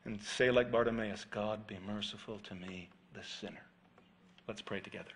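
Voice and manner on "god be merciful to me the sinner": humble plea. Acted. Quoting Bartimaeus' prayer.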